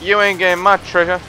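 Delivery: yelling defiance at enemies; defiant shouting. Real, not acted. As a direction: shout it back at them. Gamer taunting enemy in fight.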